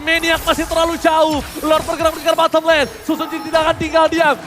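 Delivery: loud and high, then settling; excited play-by-play. Real, not acted. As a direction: keep the hype, ease off. Play-by-play after the teamfight. (komentar heboh mereda)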